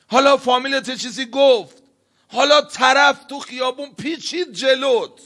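high, loud egging-on voice; the devil provoking a driver, loud and high; goading tempter. Acted. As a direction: needle him into a rage. Satan goading an angry driver.

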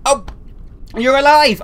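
high, loud exclamation; relieved surprise. Real, not acted. Gamer finding the character alive, loud relieved surprise. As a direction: burst out in happy disbelief.

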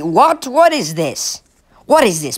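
high, baffled what is this; startled disbelief. Real, not acted. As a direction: pitch jumps way up in shock. Spots a skater with no skates.

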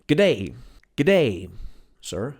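Australian accent. Shown as a demonstration. Teacher demoing an Australian greeting in Australian accent. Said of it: "to jest bardzo australijska rzecz".

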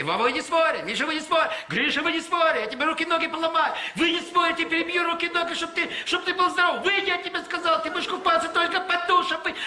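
very high scolding yell; mothers yelling. Retold or imitated. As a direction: shout at the kids to get out of the sea. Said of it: "и там крики".

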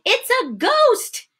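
high, drawn-out frightened cry; acted cry of fear. Disciples spotting Jesus on the water, crying out in fear. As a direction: stretch it out in mock terror. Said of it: "they cried out in fear".

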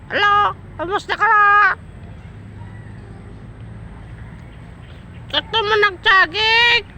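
very high squeaky coaxing call; high pet-talk voice; high bird-talk. Real, not acted. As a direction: sing it up high to coax a bird. Greeting the parrot, pet-talk.

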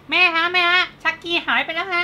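put-on high-pitched, loud child voice; imitated little boy. Andy telling his mother Chucky is gone, child voice. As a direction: pipe up like an alarmed kid.

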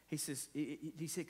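ordinary talk

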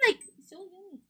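ordinary delivery

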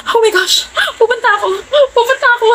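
high, teary excited squeal. Acted. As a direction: squeal with joy, near crying. Overjoyed about concert.

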